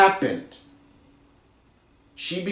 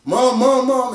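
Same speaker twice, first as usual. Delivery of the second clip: put-on scared kids; 8 semitones higher than usual. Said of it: "they were scared and they were just like".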